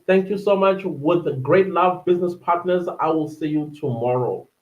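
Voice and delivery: usual speaking voice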